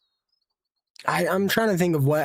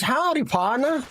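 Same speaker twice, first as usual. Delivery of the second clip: cowboy greeting; 8 semitones higher than usual. Retold or imitated. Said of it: "Somebody goes".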